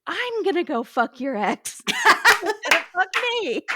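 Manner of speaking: high, loud mock comeback breaking into laughter; imagined parroting. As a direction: parrot her threat in a hammed-up high voice. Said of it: "I thought he was gonna go".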